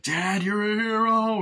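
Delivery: put-on high, slow, awed kid voice; a son greeting his hero dad, high and slow; son's voice. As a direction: gush it like an admiring boy.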